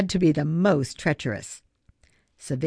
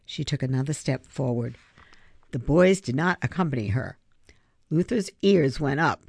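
ordinary delivery

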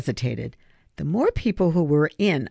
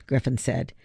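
usual speaking voice

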